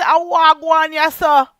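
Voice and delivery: Jamaican patois greeting. Retold or imitated. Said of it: "Me say".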